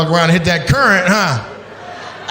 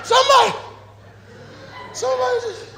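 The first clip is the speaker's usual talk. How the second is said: stranded woman calling for help; 4 dB quieter than usual. Retold or imitated. Imitating the lost camper calling from the raft, high shouts fading out. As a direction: wail for help from far out at sea, then give up. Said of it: "She's like".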